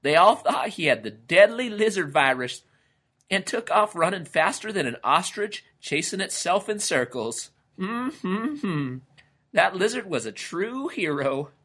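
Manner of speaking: high-voiced storyteller relishing the tale; storytelling lizard character. A narrator's reading. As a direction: tell the tall tale in a high, lively character voice.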